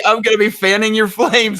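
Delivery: high playful quote voice; quoted friend. Retold or imitated. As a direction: mimic her eager line. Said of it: "She's like".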